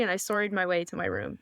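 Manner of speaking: normal talking voice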